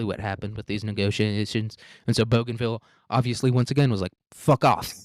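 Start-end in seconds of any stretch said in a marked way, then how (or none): 4.3-4.9 a loud, dismissive brush-off; mock fuck off. Bougainville snapping back at Papua New Guinea, loud and dismissive. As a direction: blurt it out as the fed-up islanders.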